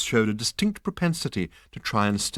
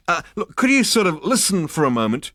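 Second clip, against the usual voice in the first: pleading Zaphod; 8 semitones higher, 6 dB louder than usual. A narrator's reading.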